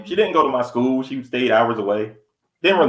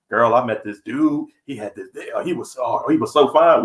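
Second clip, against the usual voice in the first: put-on gossiping girlfriend. Mimicking a woman telling her girl about a fine dude, gushing. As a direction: coo about a fine guy. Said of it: "y'all gonna be like".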